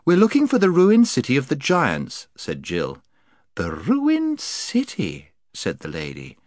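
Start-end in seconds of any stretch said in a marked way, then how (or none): 0.0-2.3 put-on high, eager young girl. Jill voiced by narrator. 3.5-5.3 high, slow, sweet lady; honeyed voice. Acted.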